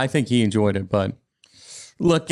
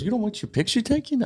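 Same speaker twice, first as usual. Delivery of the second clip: incredulous guy; 10 semitones higher than usual. Retold or imitated. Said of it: "He goes".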